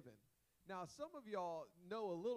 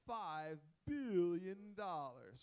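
"billion" drawn out slow and loud; stress on billion; 9 dB louder, much slower than usual. Real, not acted. Pastor stunning the congregation with the huge debt, slow and loud. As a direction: stretch out "five billion dollars" with weight.

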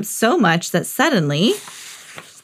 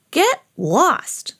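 higher, annoyed brush-off; mild get lost; 7 semitones higher than usual. A narrator's reading. Fat lady shooing Harry away, annoyed. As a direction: snap it at the dog, irritated. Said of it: "she said".

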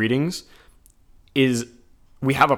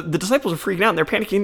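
ordinary talk

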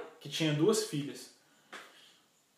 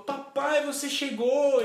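put-on high child's joyful greeting; acted little girl's excited welcome; delighted daughter; 10 semitones higher, 5 dB louder, slower than usual. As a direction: cry out happily as daddy gets home. Younger daughter greets father home.

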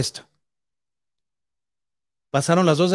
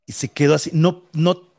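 ordinary talk

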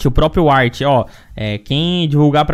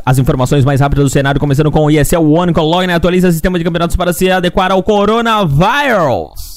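news read; 2 semitones higher than usual. Acted. (locução de notícias)

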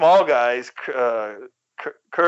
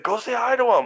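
much higher, insistent teasing; 10 semitones higher than usual. Retold or imitated. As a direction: imitate an older friend egging him on. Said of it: "he was teasing me".

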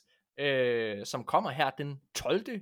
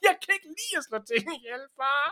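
put-on pleading child; 9 semitones higher than usual. Boy pleading with father.

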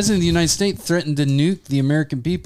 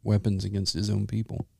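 low, quieter sarcastic delivery; deadpan sarcasm, dropping lower; 8 semitones lower, 7 dB quieter than usual. Acted. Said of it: "Did you hear my voice, my sarcastic voice?".

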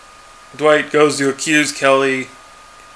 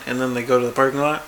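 normal talking voice

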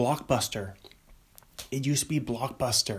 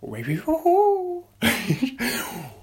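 high laugh; 11 semitones higher, 4 dB louder than usual. Real, not acted. Laughing at own bit.

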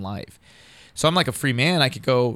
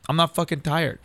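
higher-pitched, wide-awake protest; retold wired insistence; 6 semitones higher than usual. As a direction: re-enact the stubborn denial, pitch lifted. Retells overriding the tranquilizer.

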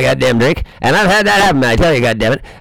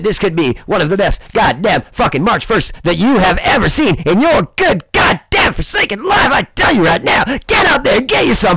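much higher, yelling hype; hype shout; 6 semitones higher than usual. Real, not acted. Urging viewers out for March first, hype shout. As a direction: whip the crowd up. Hyping the coming date.

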